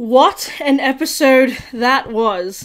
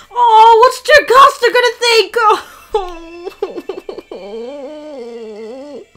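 distraught; 6 semitones higher, slower than usual. Real, not acted. Distressed reaction.

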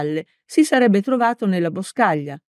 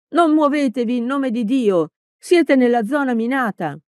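high, urgent call; urgent warning shout; 6 semitones higher than usual. A narrator's reading. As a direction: shout the warning to stop him. Warning of minefield.

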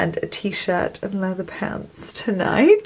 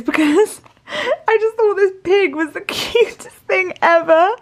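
laughing; 12 semitones higher than usual. Real, not acted. Laughing about a cute pig.

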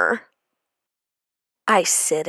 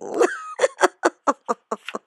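high, shrieking witch cackle. Acted. Witch teacher laughs at victims.

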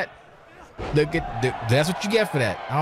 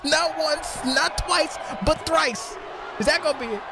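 higher, stretched shouted jeer; mocking rant; 7 semitones higher than usual. Real, not acted. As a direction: savor each miss as you mock. Ranting at wrestler's whiffs.

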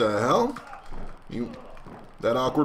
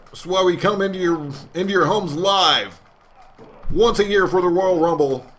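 grand, higher ringside hype for the finale; wrestling announcer; 3 semitones higher than usual. Acted. Commentator addressing ladies and gentlemen at home, hyped. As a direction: sell it like the big broadcast voice.

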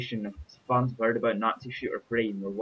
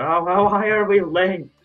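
loud, slow, high disbelief; shocked outburst; 9 semitones higher, 9 dB louder than usual. Real, not acted. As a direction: blurt it out in shock. Shocked at the game screen.

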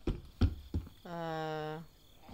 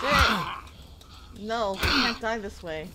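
loud, high panicked yelling; panicked shouting; 6 semitones higher, 12 dB louder, slower than usual. Real, not acted. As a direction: yell in real panic as you die. Dying to a zombie swarm.

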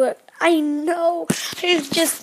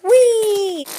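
whee; 7 semitones higher, 5 dB louder than usual. Pretend. Second car goes down the ramp.

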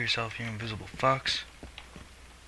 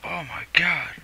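high, loud startled yell; surprised shout; 8 semitones higher, 9 dB louder, slower than usual. Real, not acted. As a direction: blurt it out in shock. Sudden scare in game.

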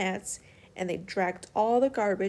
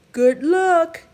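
put-on wise old clam; 8 semitones higher, 13 dB louder than usual.

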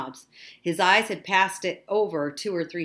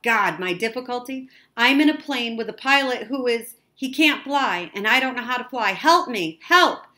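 high, loud, frantic explanation; urgent distress call building to a shout; panicked plea; 6 semitones higher, 8 dB louder than usual. A narrator's reading. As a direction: plead for help, ending on a cry. Panicked boy pleads by radio.

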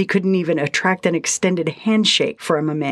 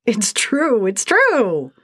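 higher, gleeful whoop; whooping with delight; 5 semitones higher, much slower than usual. A narrator's reading. Sprite whooping with delight at teasing Lindsay. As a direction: crow it in triumph. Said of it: "he whooped with delight".

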